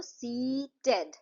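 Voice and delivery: Seated slowly split into सी and टेड; syllable by syllable. Shown as a demonstration. Teacher counting two syllables, word broken apart. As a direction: draw out सी, then a separate टेड. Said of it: "तो दो syllable हुए".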